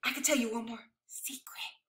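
hushed, confiding whisper; secretive whisper. Acted. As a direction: let them in on a secret.

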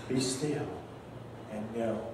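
soft repetition. Shown as a demonstration. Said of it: "repeated softly after quoting".

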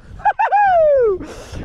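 squealing high-pitched cackle; giddy high laugh. Real, not acted. Fisher laughs at his own hype, high squeal. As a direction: burst into shrieking laughter.

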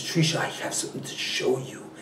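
soft, hushed hint of a French accent. The French runner showing Trisha something, hushed French accent. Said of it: "in his French accent".

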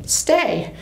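light, airy-minded defiance; breezy. A narrator's reading. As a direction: toss it off breezily. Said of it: "her voice turns breezy".

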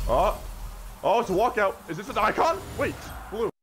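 high, loud rising excitement; hopeful squeal at the reveal; excited gasp. Real, not acted. YouTuber spotting a walkout, high and excited.

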